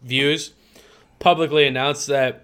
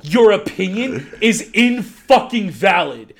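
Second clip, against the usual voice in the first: furious rant; 5 semitones higher than usual. Real, not acted. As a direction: let the fury build and spit it out. Gym rant against old man.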